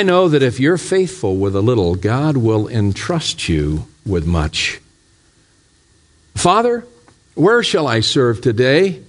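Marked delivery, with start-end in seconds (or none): 6.3-9.0 high, eager call upward; eager plea to God. Acted. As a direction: ask the Father earnestly for work. Poem speaker calls to God.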